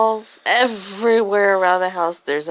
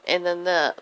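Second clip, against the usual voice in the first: plain reading voice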